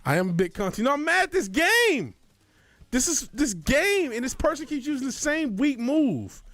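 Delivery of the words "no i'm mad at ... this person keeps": mad. Real, not acted. Venting anger at the game.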